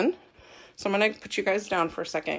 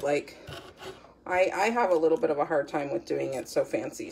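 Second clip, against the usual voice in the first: ordinary talk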